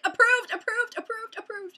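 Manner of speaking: high, giddy rapid-fire repeats; freaking out. Retold or imitated. Vlogger reliving the moment of approval, freaking out excitedly. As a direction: squeal it over and over, starting loud. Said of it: "I was, like, freaking out".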